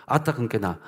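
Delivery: light Jeolla dialect grumble; mildly voiced Jeolla dialect reluctance; 전라도 사투리로 가볍게 투덜대기. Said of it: "전라도 사투리 쓰는 거였어".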